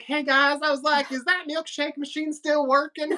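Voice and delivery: high, exaggerated Southern twang; put-on Southern accent. Asking about the milkshake machine, put-on Southern accent. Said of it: "You gotta stop talking like".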